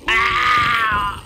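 long, loud, high yell; drawn-out playful battle yell; smashing yell. Pretend. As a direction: yell as you smash a building. Smashing the game building.

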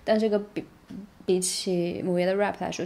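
normal talking voice

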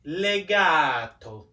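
"Legato" slow, with a long A; drawn-out Italian pronunciation. Shown as a demonstration. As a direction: stretch the middle of "Legato". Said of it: "Can we all say legato?".

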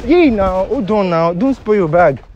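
high, loud appeal to stop; loud, high-pitched protest; urgent pleading. Real, not acted. Pleading as woman lashes out.

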